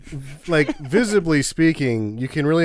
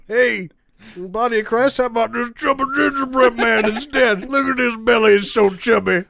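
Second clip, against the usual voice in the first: higher, playful voice offering a treat; silly offering voice; 8 semitones higher than usual. Retold or imitated. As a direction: coax like selling a cookie. Monks offering the cookie.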